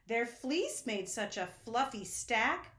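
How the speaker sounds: ordinary delivery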